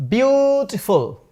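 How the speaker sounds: beautiful said slow and clear as bjutiful; beautiful clearly spelled out for the class; model pronunciation. Shown as a demonstration. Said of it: "Today's first word is".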